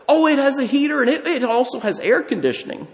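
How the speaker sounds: mock eager assurance. Seller assuring a buyer about the heater, mock eager assurance. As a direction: pitch it way up, imitating an over-assuring salesman.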